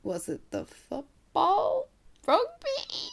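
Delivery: high, drawn-out tentative questions; clueless guessing. Retold or imitated. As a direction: squeak out wild guesses, totally unsure. Clueless reply to sports question.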